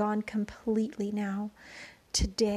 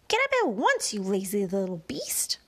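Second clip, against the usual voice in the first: furious shout; 3 semitones lower, 3 dB louder than usual. A narrator's reading. Aunt Spiker yelling at lazy James, fierce shout. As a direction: snap the boy up off the ground. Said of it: "a voice was suddenly shouting".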